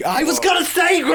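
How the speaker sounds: shouting with excitement; excited shout. Real, not acted. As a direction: yell it, thrilled.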